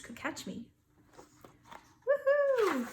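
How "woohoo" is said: very high, loud woohoo; joyful cheer; gleeful whoop. Acted. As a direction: whoop with delight. Beast celebrates escape.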